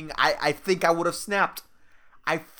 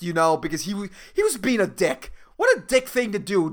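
usual speaking voice

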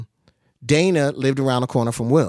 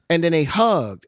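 ordinary delivery

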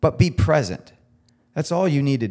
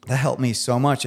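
ordinary talk, nothing marked

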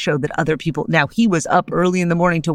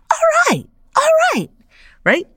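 high, loud celebratory whoop; mock cheer; 4 dB louder than usual. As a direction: whoop like a crowd at a game. Cheering on her home state.

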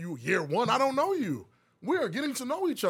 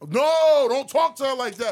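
1 protective shout; 5 semitones higher, 7 dB louder, slower than usual. Pretend. Boyfriend shouting at the imagined masseur.

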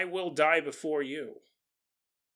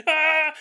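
mock shocked yelp; 11 semitones higher, 5 dB louder than usual. Listener electrocuted.

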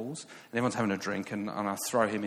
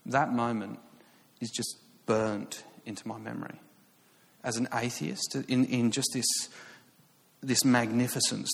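ordinary delivery

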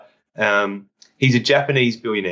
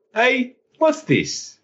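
mock surprise; 13 semitones higher, much slower than usual. Retold discovering moon project online.

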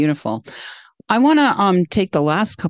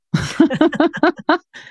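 high-pitched run of giggles; laugh; 12 semitones higher than usual. Real, not acted. Host amused by trying the guest's technique, high laugh. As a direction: burst out in high, rapid laughter.

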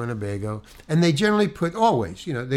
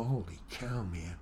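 muttered dismay; 6 semitones lower, 15 dB quieter than usual. Retold or imitated. Alone with the script, dismayed.

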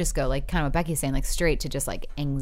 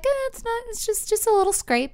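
much higher, breezy mock wife brushing it off. Retelling a comedy skit's wife, high mock voice. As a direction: squeak it out like a wife downplaying the damage.

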